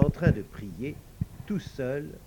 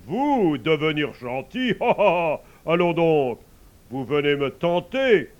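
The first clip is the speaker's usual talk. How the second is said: loud mocking pope with a laugh; angry pope laughing him off; scornful pope; 3 semitones higher, 10 dB louder than usual. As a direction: scoff at the very idea. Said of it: "de plus en plus fâché".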